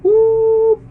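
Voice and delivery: high, loud victory whoop; excited whoop. Real, not acted. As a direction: yelp with joy at winning. Winning rock paper scissors.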